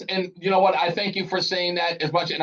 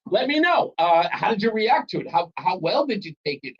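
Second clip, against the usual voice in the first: ordinary delivery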